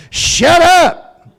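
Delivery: high, loud mock yell of an old-school parent; angry parent yell. Parent yelling at the crying kid with a broken bone, high loud mock yell. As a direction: bark it like a fed-up dad.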